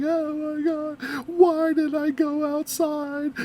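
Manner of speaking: slow, whimpering fake cry; mock crying. Reactor imagines running away from the hatchet woman, mock crying. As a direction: wail it slowly, pretending to sob. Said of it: "I would have ran away crying".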